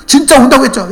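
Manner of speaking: high, loud mock interrogation; angry confronting. Cheated buyer confronts the chicken seller, accusing. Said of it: "막 따졌어요".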